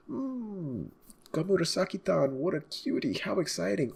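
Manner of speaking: high, gushing, smitten voice; fawning Queen. Acted. Queen fawning over a courtesan, high and gushing. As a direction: coo over her like a lovestruck fan.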